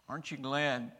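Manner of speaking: ordinary talk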